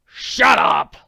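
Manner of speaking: harsh loud hissed snarl. Acted. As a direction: spit it at him, furious. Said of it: "snarled Ollie Jacobs".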